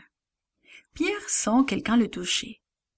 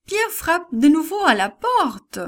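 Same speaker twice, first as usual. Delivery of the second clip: high, louder urgent insistence; insistent cry; 8 semitones higher, 5 dB louder than usual. A narrator's reading. Servant girl insisting Peter is knocking, high urgent voice. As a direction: make them listen.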